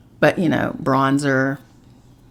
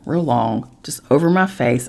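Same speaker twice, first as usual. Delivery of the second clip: ordinary talk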